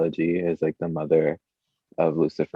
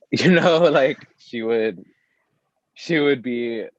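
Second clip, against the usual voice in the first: giggly; 7 semitones higher, 3 dB louder, slower than usual. Real, not acted. Giggly high aside about Joan.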